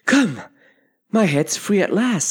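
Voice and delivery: high, relieved delight; delighted little girl, newly freed. A narrator's reading. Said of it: "in a tone of delight".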